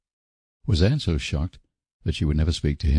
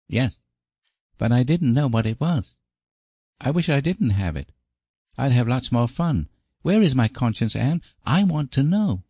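put-on high-pitched child's voice, puzzled; curious, insistent high little-boy voice; Davy, inquisitive little boy; 8 semitones higher than usual. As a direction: play a small boy demanding answers, high. Boy questions Anne about conscience.

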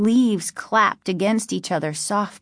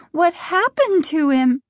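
higher, mildly alarmed question; queasy worry; 9 semitones higher, 4 dB louder than usual. A narrator's reading. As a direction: ask as if lightly sick with worry. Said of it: "feeling sick".

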